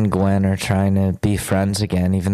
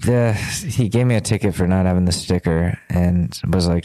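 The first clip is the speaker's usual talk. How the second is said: ordinary talk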